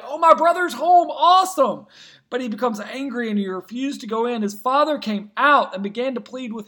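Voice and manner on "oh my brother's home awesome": higher, louder cheerful exclamation; a brother thrilled at the news; mock glad reaction to the brother coming home; delighted. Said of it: "You would expect him to be like".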